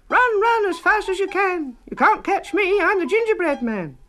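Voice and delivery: very high, loud, taunting little voice; high loud singsong taunt; gingerbread man. A narrator's reading. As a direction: tease the chasers from far ahead.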